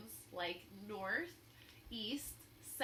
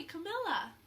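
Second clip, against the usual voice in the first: ordinary delivery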